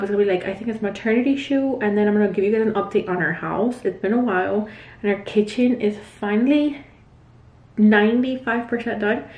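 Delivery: ordinary delivery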